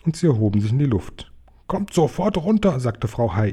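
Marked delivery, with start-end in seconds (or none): 1.7-2.8 high-pitched motherly order; alarmed mother. A narrator's reading. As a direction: call the children down in a raised pitch. Mother orders flying children down.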